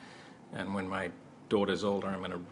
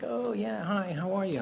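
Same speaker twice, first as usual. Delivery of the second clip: high polite greeting; 10 semitones higher than usual. Retold or imitated. Director's retold polite greeting.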